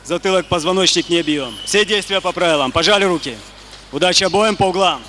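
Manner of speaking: soft, brisk referee briefing; brief both fighters quickly; referee instructions. Real, not acted.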